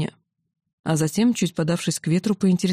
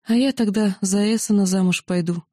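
ordinary delivery, nothing marked

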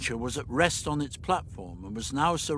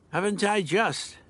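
plain reading voice

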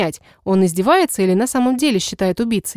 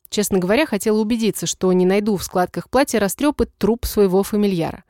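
usual speaking voice

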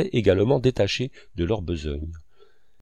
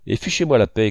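a mild narrated shout, louder at the end; 5 dB louder than usual. A narrator's reading. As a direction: snap it out, fairly loud. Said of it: "cria Fauvarque".

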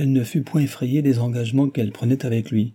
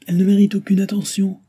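high, loud indignant dismissal; vehement protest; 10 semitones higher, 6 dB louder than usual. A narrator's reading. Groom to minister about the objecting woman, vehement protest. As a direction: dismiss it forcefully, pitched right up.

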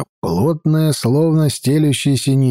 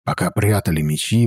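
usual speaking voice

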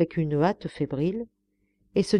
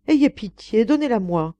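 raised, urgent entreaty; pleading; 5 semitones higher, 6 dB louder than usual. A narrator's reading. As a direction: beg for mercy. Governess begs for the slipper back.

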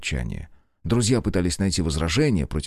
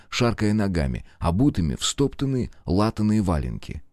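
ordinary delivery

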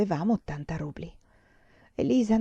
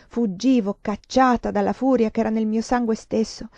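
usual speaking voice

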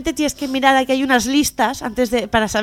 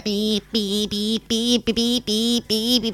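car horn beeps; 2 semitones lower, 4 dB quieter, much slower than usual. Retold or imitated.

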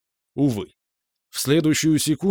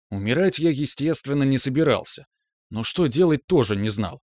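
ordinary delivery

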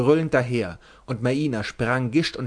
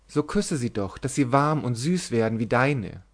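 ordinary delivery, nothing marked

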